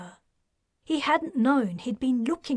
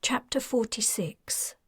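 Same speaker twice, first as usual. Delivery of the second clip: usual speaking voice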